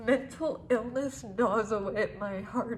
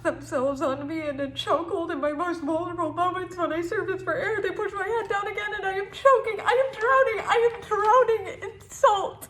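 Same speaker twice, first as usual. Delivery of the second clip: rising panic; 6 semitones higher, faster than usual. Acted. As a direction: build desperately, as if fighting for air. Poem enacts choking and drowning.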